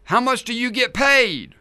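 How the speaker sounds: high and loud called question; calling out. Acted. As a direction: call it out across the dark. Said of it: "he calls out".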